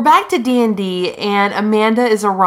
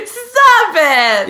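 high, laughing squeal; laughing protest; 12 semitones higher, 4 dB louder than usual. Real, not acted. Co-host begging partner to quit joking, laughing protest. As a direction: cry out through real laughter.

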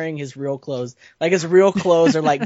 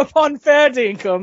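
higher, louder mock-posh British; mock British manor accent; 7 semitones higher, 5 dB louder than usual. Player joking on a manor house name, mock British accent. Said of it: "like the name of a manor house".

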